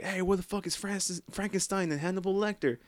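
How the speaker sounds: high mock tough voice.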